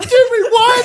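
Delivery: high, loud, thrilled; excited shout. Retold or imitated. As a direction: yell it like you can't believe it. Realizing they won the tournament.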